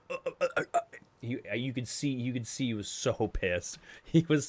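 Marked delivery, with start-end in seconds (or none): 0.1-0.8 string of short, high "uh" noises; mock speechless stammer. Inmate stumped by the bus answer, mock sputtering. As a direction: imitate sputtering, unable to get a word out. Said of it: "he was just kinda like".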